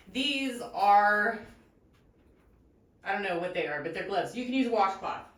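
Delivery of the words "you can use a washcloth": normal talking voice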